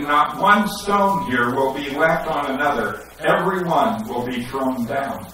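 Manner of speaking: ordinary delivery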